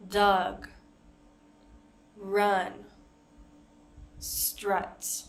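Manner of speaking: "Dug," "run," "strut." said clearly with a short "uh" vowel; spaced teacher model of "Dug," "run," "strut."; model words. Shown as a demonstration. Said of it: "teacher repeats model words".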